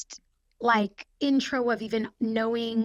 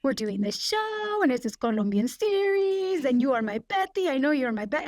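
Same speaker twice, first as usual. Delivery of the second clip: Salma Hayek; 3 semitones higher than usual. Retold or imitated. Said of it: "Sorry for that accent".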